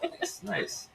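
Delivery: ordinary delivery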